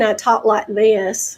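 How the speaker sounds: slow Southern drawl; Deep South accent. Shown as a demonstration. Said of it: "I'm actually from the Deep South".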